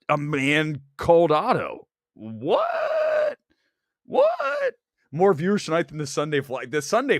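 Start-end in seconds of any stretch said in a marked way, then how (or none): 2.1-4.7 much higher squeaky "What?"; squeaky double-take at the viewer numbers; shocked squeal. Real, not acted. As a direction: leap up into a squeal of disbelief. Surprised by viewer count.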